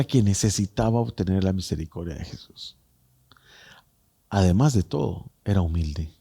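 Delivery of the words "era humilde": usual speaking voice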